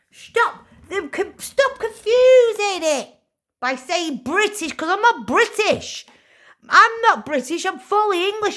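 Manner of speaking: high, loud, furious shout; shouting that she is English, not British; angry shouting. Real, not acted. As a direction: yell it out, outraged. Shouting rant about being English.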